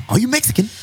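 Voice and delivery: high, laughing attempt at an Irish accent; failed Irish accent. Shown as a demonstration. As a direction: try an Irish accent and crack up. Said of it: "it doesn't come out".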